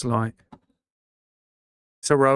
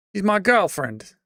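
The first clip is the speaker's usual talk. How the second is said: higher, louder mock British accent on girlfriend; over-the-top British take on the line; British accent attempt; 8 semitones higher, 4 dB louder than usual. Shown as a demonstration. Streamer to chat, first try at a British girlfriend. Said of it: "I don't know how to say girlfriend in British accent".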